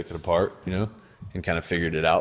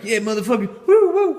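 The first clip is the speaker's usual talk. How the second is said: high, louder whooping party cry; hyped energy; 8 dB louder than usual. Pretend. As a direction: whoop like energy let loose. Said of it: "energy is like".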